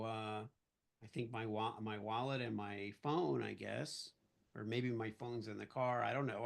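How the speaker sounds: low, soft, hesitant mumble; nervous. Retold or imitated. Suspect answering police about his pockets, nervous. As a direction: answer meekly, unsure of yourself. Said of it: "I'm, like, nervous at this point".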